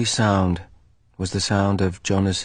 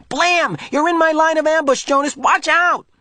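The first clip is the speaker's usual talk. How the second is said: high, loud playful warning; boy playing war warns friend; excited game shout; 4 dB louder than usual. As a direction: shout across the field in play.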